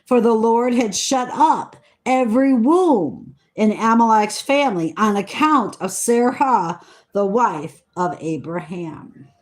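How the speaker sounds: measured, solemn Bible reading voice; scripture reading. Acted. Reading Genesis to her listeners, in a solemn reading voice. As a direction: read the old words with weight and care.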